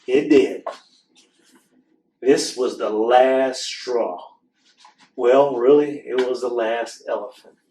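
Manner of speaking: lively storybook read-aloud; reading to children. Acted. Host reading a book to children, animated storytelling. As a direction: read the picture book to the kids with expression.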